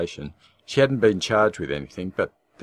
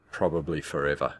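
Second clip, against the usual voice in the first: plain reading voice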